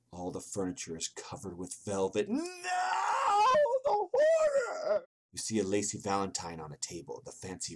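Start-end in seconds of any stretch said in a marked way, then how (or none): 2.3-5.1 very high, loud, drawn-out wail; mock horror scream. Player reacting to velvet-covered furniture, mock horror scream. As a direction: scream in over-the-top horror.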